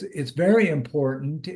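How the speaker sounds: ordinary talk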